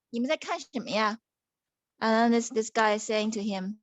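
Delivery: ordinary delivery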